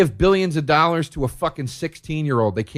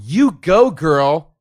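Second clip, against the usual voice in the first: mock cheer; 2 semitones higher, 6 dB louder, much slower than usual. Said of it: "female singers that are going".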